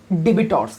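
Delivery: ডেবিটর্স with the b said aloud, de-bi-tors; mock the common mistake ডেবিটর্স, b pronounced; wrong pronunciation. Shown as a demonstration. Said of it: "অনেকে এটাকে ভুলভাল উচ্চারণ করে".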